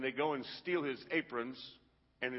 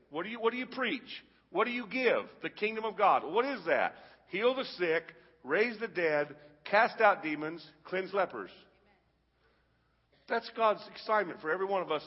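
raised, punchy questions and answers; emphatic preaching; 4 semitones higher, 4 dB louder than usual. Real, not acted. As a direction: drive each command home to the congregation.

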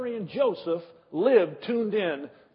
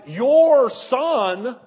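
"your son" hit much louder, rising at the end; "your son" punched loud and rising; stress on your son; 4 semitones higher, 10 dB louder than usual. Pretend. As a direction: lean hard on "your son". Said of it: "and let me emphasize".

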